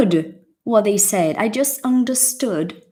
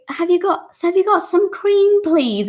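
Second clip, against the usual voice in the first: mock customer; 11 semitones higher than usual. Mimicking a British customer asking.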